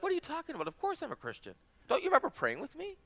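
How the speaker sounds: high, softened voice of wounded disbelief; mock indignant protest. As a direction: imitate a young man squeakily protesting his faith. Acted young man protesting to pastor.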